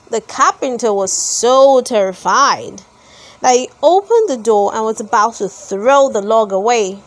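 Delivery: usual speaking voice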